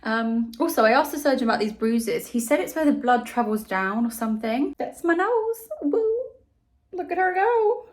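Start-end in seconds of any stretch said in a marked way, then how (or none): 4.8-6.0 squeaky high cute voice, much higher; high playful voice. Real, not acted. Showing off her new nose. 6.9-7.9 much higher, cutesy voice; high playful cooing. Real, not acted. Cooing over her nose.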